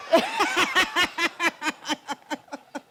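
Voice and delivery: long high laugh. Real, not acted. Mother laughing about taking back her kitchen keys, real laugh. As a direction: let a real laugh roll out.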